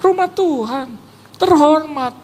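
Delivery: high, put-on earnest voice; mock emphatic reply. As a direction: insist with exaggerated reverence. Mengulang jawaban bersuara tinggi. (menegaskan hormat dengan suara tinggi)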